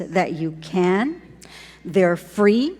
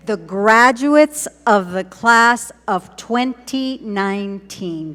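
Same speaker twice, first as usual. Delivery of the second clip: loud, slow ceremonial announcement; slow, ringing repeat with big emphasis; rousing proclamation; 4 dB louder than usual. Real, not acted. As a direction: proclaim it louder for a cheering crowd. Repeating it to rouse the crowd.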